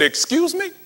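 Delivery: high, disbelieving "Excuse me?"; incredulous. Retold or imitated. Agent reacts to counteroffer.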